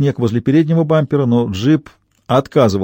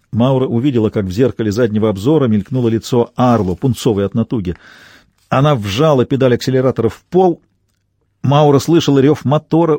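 ordinary delivery, nothing marked

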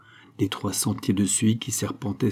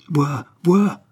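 urgent exclamation; 6 semitones higher, 5 dB louder than usual. A narrator's reading. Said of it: "dit le comte".

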